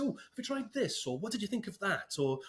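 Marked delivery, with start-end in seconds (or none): 0.0-1.1 lightly acted, high-pitched helpful query; gentle coaching question. Instructor checking with a guide dog client, gently coaching. 1.2-2.2 lightly acted, high-pitched helpful query; gentle coaching question. Instructor checking with a guide dog client, gently coaching.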